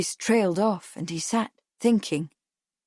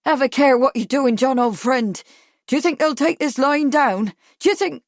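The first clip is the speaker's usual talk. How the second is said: high, loud urgent warning; raised-voice appeal to an old friend; heated protest; 6 semitones higher, 6 dB louder than usual. A narrator's reading. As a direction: plead loudly, pitch raised, until cut off. Harry's heated protest, cut off.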